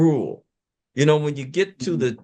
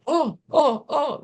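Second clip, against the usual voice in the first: high-pitched mock "oh" of dismay; high, imitated wail of concern; 12 semitones higher than usual. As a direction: imitate people fretting, voice jumping high. Mimics people's dismayed reaction to death.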